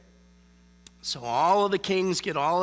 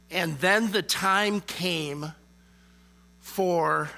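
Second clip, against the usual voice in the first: slow dramatic reading; much slower than usual. Shown as a demonstration. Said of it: "literally it says in chapter twenty-nine".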